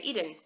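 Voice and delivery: EDEN said EE-den; English pronunciation. Shown as a demonstration. Teacher quoting the English word, EDEN as EE-den. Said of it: "So in English".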